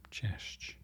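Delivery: ordinary talk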